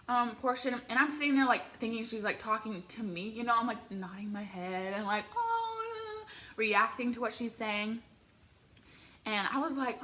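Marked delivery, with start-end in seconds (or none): 5.3-6.2 mimicked high-pitched held "oh"; high, drawn-out fan coo; high "oh" reaction. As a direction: imitate a starstruck, high "ohh". Mimics her reaction to singer.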